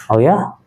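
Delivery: "Oh ya?" rising high at the end; rising, interested intonation. Shown as a demonstration. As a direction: ask "Oh ya?" with keen interest. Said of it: "Menegaskan ketertarikan".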